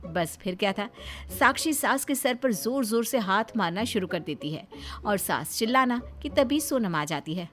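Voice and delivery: ordinary delivery, nothing marked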